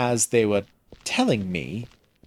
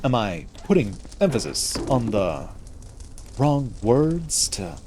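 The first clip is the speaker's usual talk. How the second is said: William Shatner. Acted. Streamer mocking own odd emphasis to viewers, hammy dramatic halting. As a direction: break the sentence up with heavy, misplaced emphasis. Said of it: "Do I just sound like William Shatner?".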